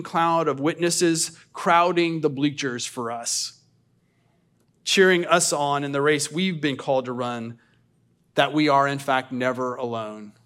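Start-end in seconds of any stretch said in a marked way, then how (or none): none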